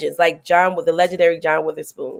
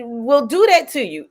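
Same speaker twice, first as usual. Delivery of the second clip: ordinary talk, nothing marked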